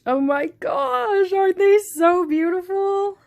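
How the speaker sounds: high, loud, adoring gush; gushing delight. Real, not acted. Shoe fan admiring her new pair, gushing delight. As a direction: gush with real delight, pitched right up.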